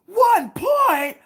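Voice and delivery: high, loud mock shriek of outrage; outraged shriek. Daughter outraged over a B grade, high mock shriek. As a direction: shriek it like a furious kid.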